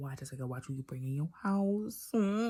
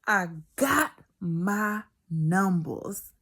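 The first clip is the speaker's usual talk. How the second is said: slow triumphant; 4 semitones higher, 7 dB louder, much slower than usual. Real, not acted.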